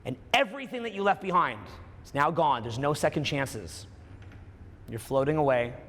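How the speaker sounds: low, soft, hushed guiding voice; almost whisper, draw them inward; hushed meditation. Acted.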